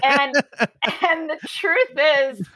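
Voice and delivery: usual speaking voice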